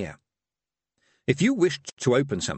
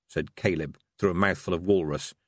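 plain reading voice

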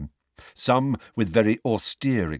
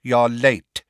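cold, ringing rebuke, louder and raised; 4 semitones higher, 7 dB louder than usual. A narrator's reading. Cold male voice across the courtroom to Harry, cold and ringing. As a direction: ring it out coldly across a courtroom. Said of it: "A cold male voice rang across".

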